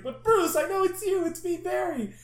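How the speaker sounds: high, loud, panicked begging; desperate pleading. Retold or imitated. Barry begging the Batman who beats him, high panicked plea. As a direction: plead for him to recognize you. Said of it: "he's like".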